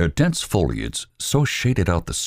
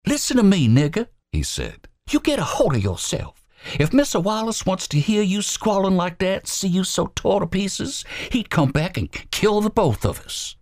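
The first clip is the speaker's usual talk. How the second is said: much higher, forceful hushed command; fierce. Acted. Albert pinning Robert Lee, fierce. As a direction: get hold of him hard.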